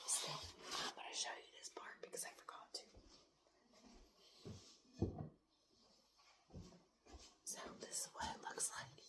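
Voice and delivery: late-night whisper so nobody wakes; hushed whisper, parents asleep nearby. Real, not acted. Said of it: "Sorry, I'm whispering".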